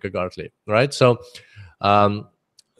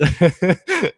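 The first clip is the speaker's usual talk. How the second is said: higher chuckle; real laugh; 7 semitones higher than usual. Real, not acted. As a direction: chuckle at the name. Laughing at Abbott joke.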